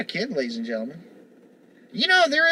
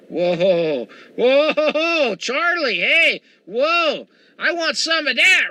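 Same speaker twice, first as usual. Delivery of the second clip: loud, higher, eager hooting; excited whoa; 5 semitones higher, 4 dB louder, much slower than usual. Retold or imitated. Man ogling TV women, hooting loud and eager. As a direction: hoot like a guy who just saw something he wants.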